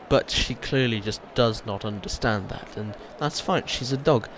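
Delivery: ordinary talk, nothing marked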